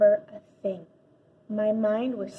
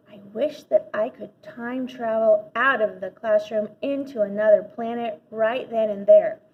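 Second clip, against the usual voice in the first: ordinary delivery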